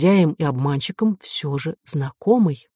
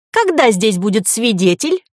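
put-on old man whining like a hurt child; higher, peevish voice of an aged, offended child; nasty offended-child voice; 5 semitones higher, 3 dB louder than usual. A narrator's reading. Professor demanding the witness, in a nasty offended-child voice. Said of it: "противным голосом старенького обиженного ребёнка".

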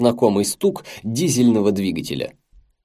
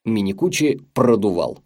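ordinary delivery

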